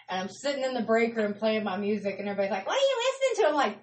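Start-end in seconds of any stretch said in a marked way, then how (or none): 2.7-3.5 high-pitched mock questioning; coworkers' puzzled question. Worker mimicking coworkers in the break room, high puzzled question. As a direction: quote them in a much higher voice.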